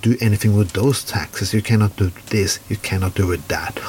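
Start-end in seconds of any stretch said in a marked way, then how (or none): none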